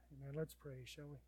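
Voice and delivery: ordinary delivery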